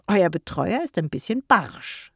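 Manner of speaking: lightly voiced old woman, amused; old neighbour teasing the children about their uncle, amused; amused old lady. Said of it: "sagte sie amüsiert".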